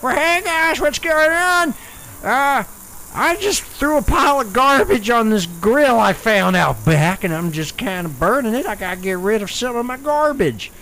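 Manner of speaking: put-on old fellow character voice; Ernie Bentley. Old contestant explains his garbage fire, old fellow voice. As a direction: play a rambling old guy. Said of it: "His name's Ernie Bentley".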